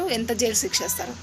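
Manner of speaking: ordinary talk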